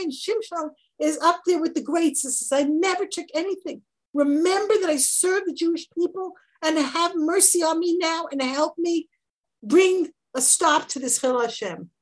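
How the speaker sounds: pleading sermon at full force; impassioned preaching. Acted. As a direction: preach it with rising fervour. Impassioned teaching of Shimshon's plea.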